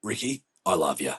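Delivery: low, soft, mock-solemn declaration of love; hushed, deeply touched retold reply; heartfelt affection. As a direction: drop down quietly and mean it. His smitten reply to Ricky.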